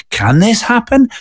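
high, slowed disbelieving question; mock incredulous. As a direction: voice a doubting viewer. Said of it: "things that feel kind of".

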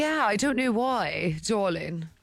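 drawn-out posh darling. Pretend. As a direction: play the posh toff. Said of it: "posh darling on purpose".

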